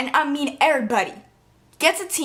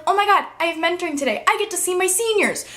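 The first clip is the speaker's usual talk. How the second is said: excited freshman; 7 semitones higher, faster than usual. Retold or imitated. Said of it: "they're like".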